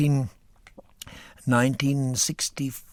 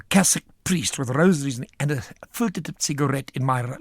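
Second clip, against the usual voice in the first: ordinary talk, nothing marked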